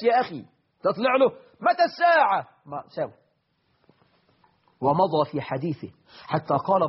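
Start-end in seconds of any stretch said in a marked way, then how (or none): 1.6-2.5 much higher, insistent blurted question; interrupting Bedouin. Retold or imitated. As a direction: blurt it out rudely, cutting the Prophet off. Bedouin's rude interruption mocked. (سؤال مقاطع بصوت مرتفع جدًا)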